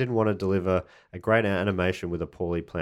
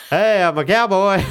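high, loud toy cowboy; pull-string doll cowboy; Woody pull-string voice; 11 semitones higher, 7 dB louder than usual. Retold or imitated. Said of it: "he'll say different phrases".

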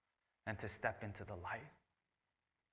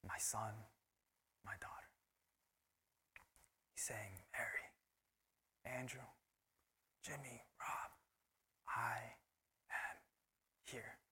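hushed, softer, calling each name gently; tender voice of God; 6 dB quieter than usual. Acted. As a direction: whisper names like a loving father. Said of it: "And God is saying".

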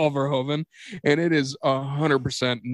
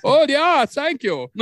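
high, loud mock-Dutch director; hammed-up European director impression; Verhoeven; 13 semitones higher, 5 dB louder, slower than usual. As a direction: gush it in a cartoonish accent. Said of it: "Paul Verhoeven shot".

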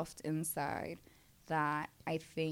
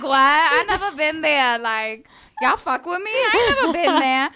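high, loud stunned disbelief; amazed disbelief; 9 semitones higher, 15 dB louder than usual. Retold or imitated. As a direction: gasp it out in disbelief. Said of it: "that's why I'm like".

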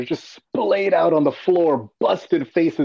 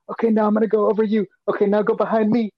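mock action banter; 7 semitones higher, faster than usual.

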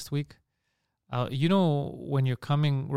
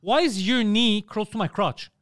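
higher, slower indignant question; exasperated retelling; 6 semitones higher, 3 dB louder, slower than usual. Retold or imitated. Passenger retelling a challenge to his encroaching seatmate, exasperated. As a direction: spell out the mock complaint slowly. Retold quarrel over plane seat.

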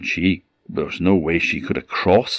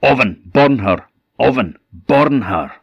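louder, fierce order; shouted command; 5 semitones higher, 5 dB louder than usual. A narrator's reading. Magic chest shouting at the oven to burn her. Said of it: "and the chest shouted up".

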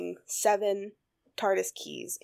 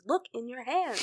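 higher, firmer retold command; insistent; 5 semitones higher than usual. Doctor repeats it to arguing Clara, insistently. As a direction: press the point in a raised pitch.

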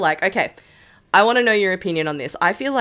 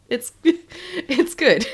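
laughing; 8 semitones higher, 4 dB louder, much slower than usual. Real, not acted. Laughing through the words.

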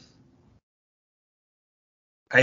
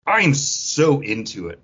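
ordinary talk, nothing marked

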